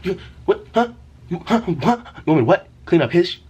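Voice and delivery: high, sputtering disbelief; indignant teenage self. Retold or imitated. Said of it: "My teenage self goes".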